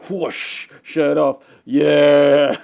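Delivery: loud whooping cheer; triumphant yell. Pretend. Celebrating after nailing a sale at work, loud cheer. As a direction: belt it out like you just won.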